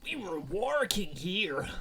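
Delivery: slow, hammed-up "working"; exaggerated slip. Real, not acted. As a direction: stretch "working" slowly, mocking your own mistake. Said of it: "What's wrong with me?".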